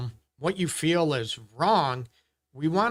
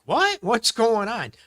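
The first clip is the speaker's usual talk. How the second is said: a sharp, much higher and louder "What?"; shocked disbelief; 8 semitones higher, 4 dB louder than usual. Retold or imitated. A counsellor mimics reacting to a dismissive partner, stunned and disbelieving. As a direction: act baffled, as if you can't believe your ears. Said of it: "And we're like".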